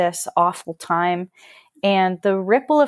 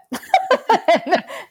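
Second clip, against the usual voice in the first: real high burst of laughter; 11 semitones higher than usual. Real, not acted. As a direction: break up laughing. Laughs at mother's haircut line.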